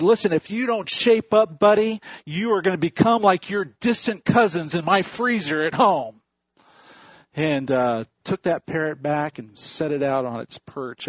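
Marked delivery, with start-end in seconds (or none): 0.0-6.2 higher, louder threatening scold; stern owner. Acted. Pet store owner threatening the parrot, loud stern scold. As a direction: lay down the law to the bird.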